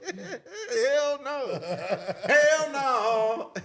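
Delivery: high, loud laughing no; laughing refusal. Real, not acted. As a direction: refuse while cracking up. Refusing another smelling salt.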